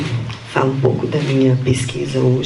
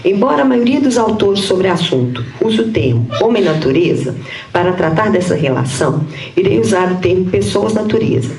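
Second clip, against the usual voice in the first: higher-pitched, measured read-aloud voice; formal paper reading; 6 semitones higher than usual. Real, not acted. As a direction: read out a prepared academic text. Reads her prepared paper aloud. (leitura formal de texto acadêmico)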